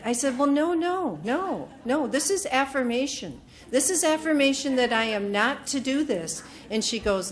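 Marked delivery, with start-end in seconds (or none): 0.4-6.4 higher, louder no no; emphatic, pitched-up protest; insistent refusal. Retold or imitated. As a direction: push back on the card. Refusing to hand card.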